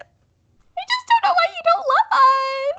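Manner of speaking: very high, loud fake sob; hammed-up drawn-out squeal of heartbreak; mock wailing. Begging listeners for love, very high mock wail.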